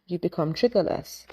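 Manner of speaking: ordinary delivery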